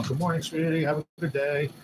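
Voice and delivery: imitating girlfriend. Reading out his girl's morning text in her sweet voice. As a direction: lightly mimic her text message.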